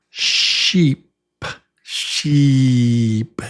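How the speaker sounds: sheep with a long, spread-lip E; smiling long E. Shown as a demonstration. Teacher shows the long E in sheep. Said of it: "you say it with a smile".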